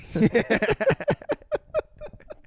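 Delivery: shrieking high laugh; high cackle. Real, not acted. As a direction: burst into a squealing laugh. After the mock line.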